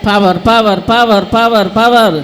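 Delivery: full-force preaching. Acted. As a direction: stir the crowd to cry out.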